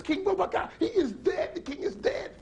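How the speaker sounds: very high, urgent shout; frantic cry. Acted. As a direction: call the crowd in panic. Lady wails the news again.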